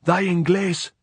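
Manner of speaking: high, loud, scornful cry; angry shout. A narrator's reading. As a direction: hurl it up the hill. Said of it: "And she shouted a flood of obscenity".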